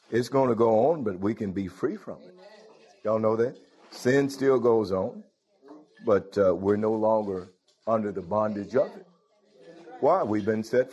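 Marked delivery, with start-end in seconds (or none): none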